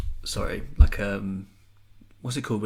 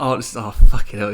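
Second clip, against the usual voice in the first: exasperated curse; 13 dB louder than usual. Retold or imitated. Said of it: "and he's like".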